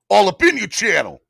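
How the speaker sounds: loud, high hammed-up New York accent. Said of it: "Gotta use my New York accent".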